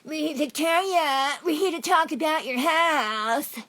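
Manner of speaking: high, loud, drawn-out caricature; mocking female officer. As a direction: mimic the officer in a loud, slow, high-pitched put-on voice. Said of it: "the female pig speaks, and it says".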